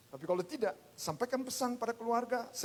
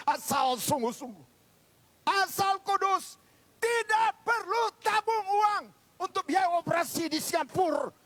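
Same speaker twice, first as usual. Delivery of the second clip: high, loud pulpit cry; impassioned preacher at full volume; full-force preaching; 8 semitones higher, 8 dB louder, slower than usual. Real, not acted. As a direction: proclaim it loudly to the whole congregation.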